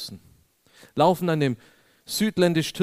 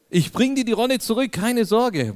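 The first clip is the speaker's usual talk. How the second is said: high, louder overeager promise; mock eager reassurance; 9 semitones higher, 4 dB louder than usual. Preacher replaying his plea to the suspicious man, high hammed-up reassurance. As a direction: play the innocent guest begging to be trusted.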